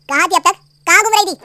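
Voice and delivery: loud, sharp questioning of where she was; angry demand. Acted. Husband angrily demanding where wife and mother were. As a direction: bark the questions at them.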